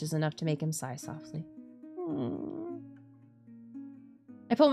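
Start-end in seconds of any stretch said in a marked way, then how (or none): 2.0-2.8 high soft hum. Real, not acted. Tender scene, reacting.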